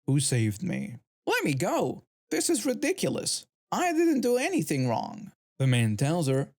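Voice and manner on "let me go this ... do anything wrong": pitched well higher, indignant and protesting; high-pitched indignant protest, played lightly; mock yelling protest. A narrator's reading. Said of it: "the woman yelling in the background".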